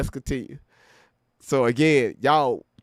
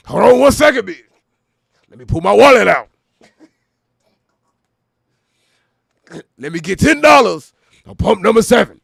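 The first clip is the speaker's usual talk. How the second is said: loud, higher barking rapper voice; 4 semitones higher, 11 dB louder than usual. Retold or imitated. [name] at the gas station yelling at the clerk. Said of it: "Can you imagine him".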